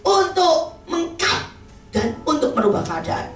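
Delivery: high-pitched, impassioned scolding; scolding rebuke. Retold or imitated. Acting out counselor's harsh rebuke. (menegur keras dengan nada tinggi)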